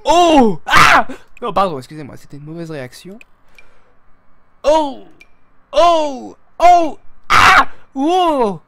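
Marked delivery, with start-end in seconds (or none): 0.1-1.1 high, loud "oh" into a shout; startled cries. Real, not acted. Streamer reacting to an outfit, loud high exclamations. 4.6-8.6 string of high, loud oohs and aahs; exaggerated wowed cries. Streamer wowed by an outfit, loud high exclamations.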